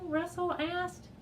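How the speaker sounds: ordinary delivery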